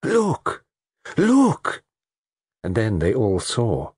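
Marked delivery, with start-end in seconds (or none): 0.0-1.8 high, startled cry; alarmed cry. Acted. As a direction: cry out in shock, pitched very high. Lola's shocked cry at the body.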